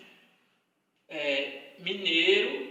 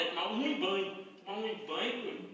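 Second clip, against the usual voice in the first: lightly mimicked Minas Gerais "tomar um banho"; put-on mineiro accent; mineiro pronunciation demo; 4 dB quieter than usual. Shown as a demonstration. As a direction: show how a mineiro runs the words together. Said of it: "Não é nem tomar banho".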